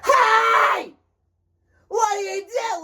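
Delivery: very high shrieked mock yell; yelling high school girls. High school girls to each other, mock screaming. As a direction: shriek like a screaming teenage girl. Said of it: "impression of how they were speaking".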